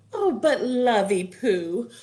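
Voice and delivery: high, stretched-out complaint; singsong protesting wife; wizard's wife voice. A narrator's reading. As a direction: complain sweetly in a high, slow voice. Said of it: "said the wizard's wife".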